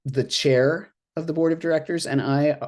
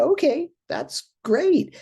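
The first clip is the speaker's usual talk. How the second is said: high, slow, sing-song agreement; high breezy voice; slower than usual. Retold or imitated. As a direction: chirp it back, slow and bright. Everybody's breezy reply, high and slow.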